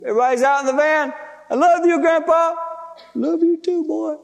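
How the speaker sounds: high, emotional back-and-forth farewell; acted tearful goodbye. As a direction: imitate goodbyes said through tears. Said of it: "we're crying so hard".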